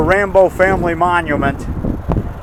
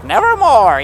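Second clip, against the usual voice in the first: lightly imitated raven, raised pitch; raven quote; 6 semitones higher, slower than usual. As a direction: quote the raven from the old movie. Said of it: "like the Raven in the movie".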